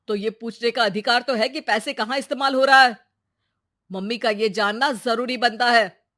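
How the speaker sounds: loud, stern reprimand; stern father raising his voice; scolding father. Acted. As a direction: tell the son off forcefully.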